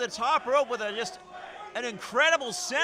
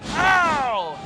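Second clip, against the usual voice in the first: pained yelp; 3 semitones higher, 8 dB louder than usual. Acted. Sympathetic cry at move.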